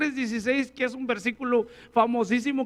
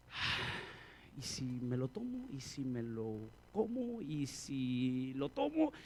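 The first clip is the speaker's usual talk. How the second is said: very soft, slow, lower tempting murmur; whispered temptation; 11 semitones lower, 14 dB quieter, slower than usual. Retold or imitated. Believer's inner craving, soft and slow. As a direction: tease yourself with the forbidden idea.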